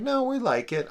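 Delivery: high mock child protest; mock kids' voice. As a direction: squeak it out like a kid insisting. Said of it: "And they're like".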